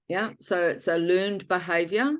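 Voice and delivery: ordinary talk, nothing marked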